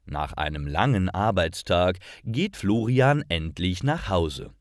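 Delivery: usual speaking voice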